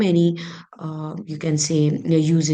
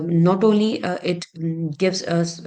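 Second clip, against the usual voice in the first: ordinary talk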